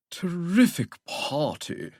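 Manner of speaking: high, slow, affected English drawl; droning English accent. A narrator's reading. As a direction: drone it in a ridiculous English accent. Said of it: "Perfidious Withering droned in that ridiculous English accent".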